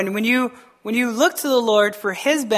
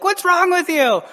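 baffled family; 9 semitones higher than usual. Retold or imitated. Family in car questioning him.

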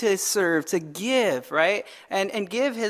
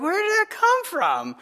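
high, baffled question; surprised; 9 semitones higher than usual. Retold or imitated. Believer baffled by sudden trouble, high mock surprise. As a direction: ask it, caught off guard. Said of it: "and you'll be like".

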